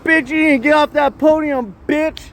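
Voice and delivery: high-pitched mock yell at a rival; over-the-top taunting voice, pitched high; acted yelling. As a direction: ham it up like a trash-talking heckler. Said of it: "let me do one more piece of acting".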